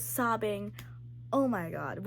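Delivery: ordinary talk, nothing marked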